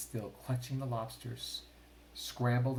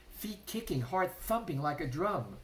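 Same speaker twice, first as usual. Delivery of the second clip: usual speaking voice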